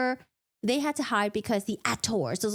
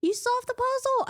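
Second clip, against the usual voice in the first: very high, whiny questioning; hammed-up exasperated wail; high mock whine; 14 semitones higher than usual. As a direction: scold in a squeaky, stretched-out voice. Exasperated reply mimicking the crying.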